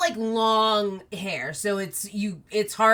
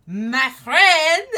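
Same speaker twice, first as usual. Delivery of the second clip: loud joyful cry of welcome; excited greeting; 6 semitones higher, 8 dB louder than usual. Acted. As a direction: burst out happily at a friend. Chess greets March Hare excitedly.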